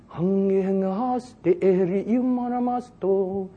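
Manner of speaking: slow, drawn-out intoned syllables; praying in tongues. Shown as a demonstration. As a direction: demonstrate a level, chant-like prayer tongue. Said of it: "And you could be praying".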